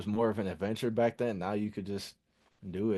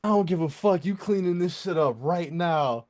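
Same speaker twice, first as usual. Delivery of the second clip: high, raised angry-mother voice; loud, indignant retold scolding; yelling mom; 8 semitones higher, 6 dB louder than usual. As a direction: imitate a mom yelling at her kid. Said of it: "she was yelling at me".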